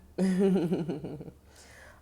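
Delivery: loud rippling laugh; big real burst of laughter; gleeful cackle. Real, not acted. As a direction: cackle loudly in a long, rapid run. Reader laughs after "tea time.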